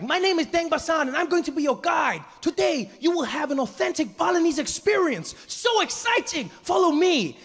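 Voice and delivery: very high, overexcited tour guide; relentlessly cheerful guide announcing the day; Deng Basan. Acted. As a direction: hype the tour in a high-pitched voice. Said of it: "the little tour guide, really happy".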